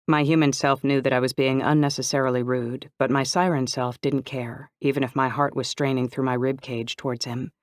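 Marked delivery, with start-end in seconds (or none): none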